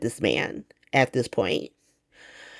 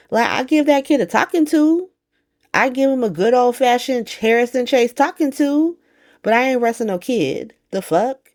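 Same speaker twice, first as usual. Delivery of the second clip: Chase talking tough; 7 semitones higher than usual. Retold or imitated. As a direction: puff up like the proud officer. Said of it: "he tells Drew".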